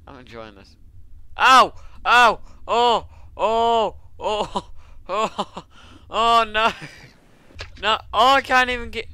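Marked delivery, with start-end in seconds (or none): 1.4-5.6 loud, high "ow" and "oh" cries; startled gamer yelping at full volume; yelps of alarm. Real, not acted. Player suddenly hurt in the game. 6.1-9.1 loud, high cry of alarm; real gamer panic, pitched up high; panicked shout. Real, not acted. Player panics while under attack.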